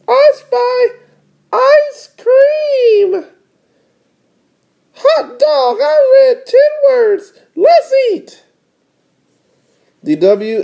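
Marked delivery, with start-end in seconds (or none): 0.1-8.4 very high, triumphant little-sister squeal. Acted. Little sister winning the ice cream bet, very high crowing. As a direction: crow it in a squeaky cartoon kid voice. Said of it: "said D W".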